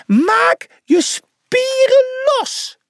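slow, very high squeaky yell; tiny coach's piercing, stretched-out order; bellowing. A narrator's reading. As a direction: holler it drawn out in a tiny mouse voice. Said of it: "brulde hij".

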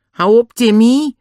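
high, loud, drawn-out scolding; slow, exasperated order to a dog. Acted. Annie tells the panting dog to stop, scolding.

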